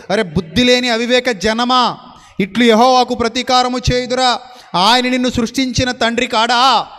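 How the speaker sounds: loud, ringing preacher's reading of the verses; forceful scripture reading. Acted. Preacher proclaiming scripture to the church, loud and ringing.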